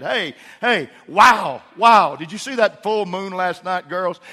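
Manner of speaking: wow called out high and loud; excited dad. Retold or imitated. Dad calling his girls to the moon, excited and loud. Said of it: "Just mention it".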